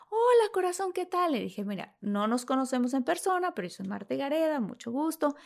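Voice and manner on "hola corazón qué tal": much higher sugary greeting; high sing-song celebrity hello. Retold or imitated. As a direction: coo it like a gracious star. [name] greeting her.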